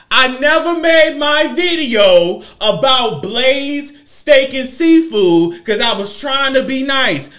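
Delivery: loud, slow, high declaration; loud, slow, drawn-out call-out; loud rant. Real, not acted.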